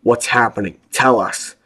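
Will demanding news from below; demanding shout. A narrator's reading. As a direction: insist on an answer. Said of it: "Will demanded".

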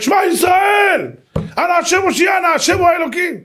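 high, stretched battle-cry prayer; commander shouting. Retold or imitated. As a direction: cry out the prayer to rally soldiers. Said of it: "המפקד צועק". (צעקת תפילה גבוהה של מפקד לפני קרב)

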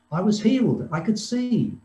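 high-pitched burst of joy; joyful exclamation. Acted. Healed blind man telling he can see, joyful exclamation. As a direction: exclaim it in amazed delight, pitch leaping up. Blind man's healing, acted monologue.

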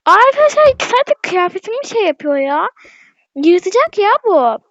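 exasperated complaint. Real, not acted. Complaining about dog.

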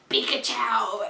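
grumpy derpy critter yell, mildly done; a touch of cartoon rage; angry Pikachu. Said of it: "But this, he's angry".

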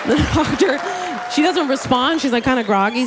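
ordinary talk

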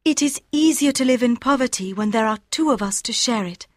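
Aouda reassuring Fogg; tender young woman. A narrator's reading.

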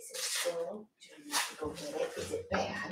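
soft, hushed coaxing of a dog; hushed puppy talk. Real, not acted. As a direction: egg the puppy on quietly.

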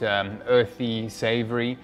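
normal talking voice

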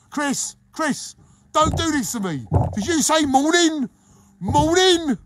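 high, loud, aghast; hammed-up shock at the word morning; mock horror. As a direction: gasp it in outraged disbelief. Horrified at a morning interview.

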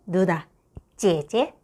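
ordinary delivery

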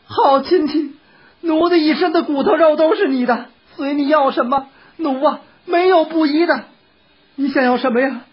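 high, appeasing woman's voice; coaxing wife. A narrator's reading. Wife promising her husband anything he asks, coaxing.

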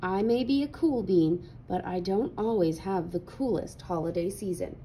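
usual speaking voice

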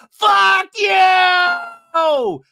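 very loud, high drawn-out scream; over-the-top imitation of a blowup; mock screaming rage. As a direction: scream it in someone's face, hammed-up. Acting Tamara in Vicky's face.

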